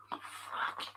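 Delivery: breathy whispered oh fuck under the breath; whispered curse. Real, not acted. Whispering to himself after hearing Downton Abbey suggested. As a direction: whisper it in quiet dismay.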